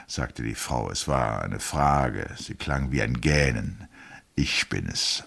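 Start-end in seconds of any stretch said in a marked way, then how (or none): 4.3-5.2 lower, firm husband's answer; husband answers his wife, low and firm. A narrator's reading. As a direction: answer steadily, low and sure. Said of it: "mit fester Stimme".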